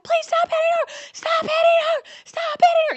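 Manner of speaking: very high, frantic yells; screaming to stop. Retold or imitated. The mom screams at the attackers to stop hitting her. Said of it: "screaming at them to stop".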